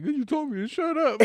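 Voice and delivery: very high-pitched imitation of a crying girl; mock tearful. Girl crying in the car answers boyfriend, mock tearful. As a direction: squeal it as a teasing fake-cry voice. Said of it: "there's just tears in her".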